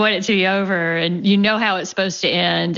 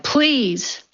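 pleading cry; 7 semitones higher than usual. Acted. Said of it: "the woman cried".

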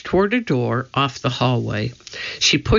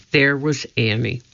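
usual speaking voice

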